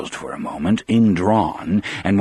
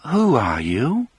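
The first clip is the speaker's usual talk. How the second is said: slow, higher but lucid woman's voice; lightly voiced Melly, now calm; clear, reasonable; 7 semitones higher than usual. A narrator's reading. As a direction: ask it sanely and clearly. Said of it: "she asked in a clear, reasonable voice".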